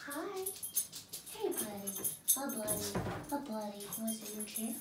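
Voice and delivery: quiet, tender voice for the dog; talking gently to the dog at the door; soft dog talk. Real, not acted. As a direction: coo softly to the pet. Greeting the dog.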